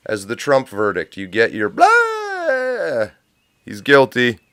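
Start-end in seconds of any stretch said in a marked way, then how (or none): 1.2-3.1 mock cry. Mocking wail.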